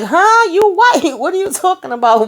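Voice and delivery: high, laughing disbelief; incredulous. Retold or imitated. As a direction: squeak out the shock, then laugh it off.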